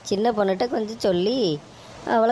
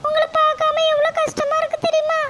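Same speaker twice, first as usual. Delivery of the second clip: put-on pleading little girl. Granddaughter begging her mother not to leave, in a high child's voice. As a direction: beg like a small child missing her mum. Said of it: "பாப்பா கூட்டிட்டு வாம்மா".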